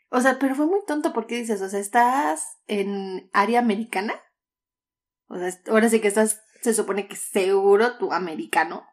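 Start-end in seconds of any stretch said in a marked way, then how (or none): none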